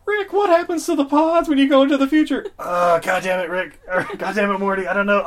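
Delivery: high, loud, frantic cartoon voice. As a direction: yell it like a flustered cartoon scientist. [name] voices Rick and Morty scene.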